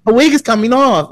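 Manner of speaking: mock English accent. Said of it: "it was the accent".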